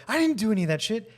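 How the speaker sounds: high, loud, fast outburst; flipping out. Retold or imitated. Victim finally snaps at the gaslighter, high fast outburst. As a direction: blurt it out in a frantic, exasperated rush. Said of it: "flip out and be like".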